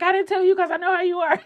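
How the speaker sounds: fast, high laughing reply; sister laughing. Retold or imitated. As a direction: laugh it off lightly. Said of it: "She was like".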